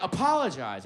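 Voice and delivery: imitated telling-off; stern order. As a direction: demand it like a cross director. Director scolding the actor, retold.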